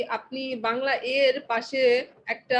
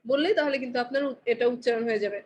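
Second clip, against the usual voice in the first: normal talking voice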